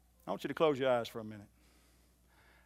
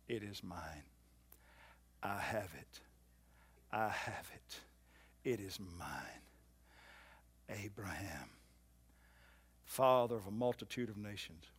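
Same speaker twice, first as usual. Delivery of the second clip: low, soft, inward declaration; soft confession; 6 semitones lower, 8 dB quieter than usual. Shown as a demonstration. Preacher modelling the confession for the church, soft and low. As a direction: murmur the promise as your own. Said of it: "Why don't you say it now?".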